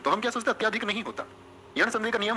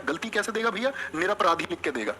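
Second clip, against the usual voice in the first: normal talking voice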